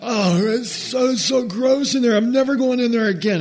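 put-on high, whiny disgusted boy; disgusted kid. Josh bursting out of the outhouse, high and disgusted. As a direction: gag it out like a grossed-out kid. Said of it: "Josh comes bursting out going".

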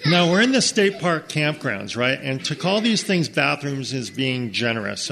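normal talking voice

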